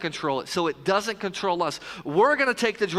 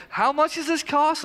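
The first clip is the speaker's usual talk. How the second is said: shocked at the price; 8 semitones higher than usual. Retold or imitated. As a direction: play the dad aghast at the checkout total. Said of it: "I'm like".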